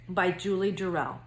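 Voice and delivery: ordinary delivery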